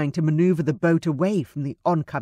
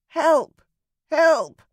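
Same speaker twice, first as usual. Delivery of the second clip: high, loud croaking bird cries; raven croak; 11 semitones higher, 5 dB louder than usual. A narrator's reading. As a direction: squawk like a raven. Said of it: "the raven croaked".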